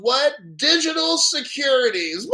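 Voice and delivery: loud, high burst of laughter; ecstatic laugh. Real, not acted. Streamer to chat, ecstatic loud laughter. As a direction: explode into delighted laughter. Ecstatic reading of hearing text.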